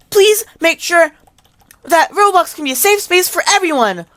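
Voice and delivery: mock ban message. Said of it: "ban message that says".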